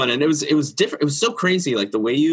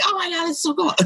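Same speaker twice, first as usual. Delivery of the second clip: high squealing mock delight; gushing customer. Customer gushing over a family portrait, high delighted squeal. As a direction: squeal like a thrilled fan.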